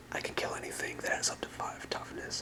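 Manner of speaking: ordinary talk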